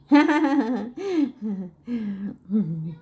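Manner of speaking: real, drawn-out giggling laugh; long laugh. Real, not acted. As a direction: laugh it out at your own remark. Laughs at her own vaccine remark. (mahabang tawa)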